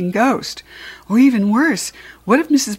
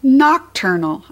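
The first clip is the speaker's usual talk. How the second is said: lightly squeaked correction, pitched up; slow, high little squeak of correction; hamster squeak; 5 semitones higher, 4 dB louder, slower than usual. A narrator's reading. Hamster corrects the class from his cage, mild squeak. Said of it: "I squeaked".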